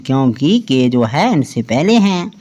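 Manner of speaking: ordinary talk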